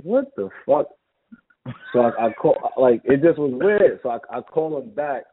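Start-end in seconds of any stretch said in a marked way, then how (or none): 0.0-0.9 high-pitched, baffled reaction; retold disbelief. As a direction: re-enact being thrown by strange news. Baffled by an unexpected phone message.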